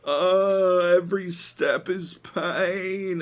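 put-on high, slow, strained old man's groan; old man shuffling. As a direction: croak it out like a frail old man in pain. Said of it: "just fucking old man walking".

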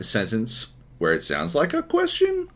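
"question" swung up at the end; mock questioning lift on "question"; upward inflection. Shown as a demonstration. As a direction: let the statement rise into a query on "question". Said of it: "your voice inflects up at the end".

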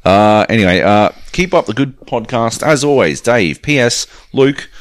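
ordinary talk

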